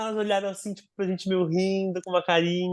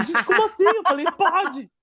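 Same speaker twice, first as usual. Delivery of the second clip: high, laughing disbelief; giddy can't-believe-it laugh; overjoyed and laughing into the phone; excited laughing; 10 semitones higher, 4 dB louder than usual. Retold or imitated. Thrilled reaction, laughing, high.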